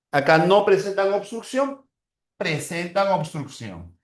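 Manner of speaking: usual speaking voice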